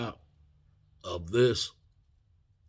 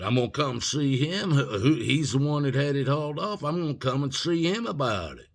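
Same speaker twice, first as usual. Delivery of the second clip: raised, higher, emphatic explanation; retold defiant insistence; 6 semitones higher, 5 dB louder than usual. Student explains himself to security head, defiant insistence. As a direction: push the point hard, voice up.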